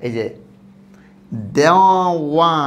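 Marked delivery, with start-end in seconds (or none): 1.6-2.5 loud, stretched দেওয়া with a clear w before a; w-glide. Shown as a demonstration. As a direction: say দেওয়া slowly, rounding into "wa". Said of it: "উচ্চারণ কি?".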